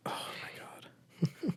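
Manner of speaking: slow breathless whisper, high. Real, not acted. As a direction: whisper it in disbelief. Aside after flat review.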